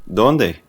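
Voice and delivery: "Dónde?" said short with a falling end; Spanish question word. Shown as a demonstration. Teacher modelling how to ask where in Spanish. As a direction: clip "Dónde?" and let it drop. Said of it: "just stick with the simple".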